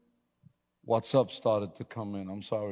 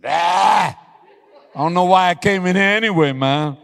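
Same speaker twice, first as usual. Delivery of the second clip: laughing retort; 7 semitones higher, 12 dB louder than usual. Retold or imitated. His own sheepish retort, laughing.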